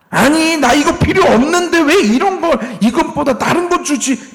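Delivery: high-pitched, put-on grumble; whiny complaint. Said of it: "불평하고 있는 거예요".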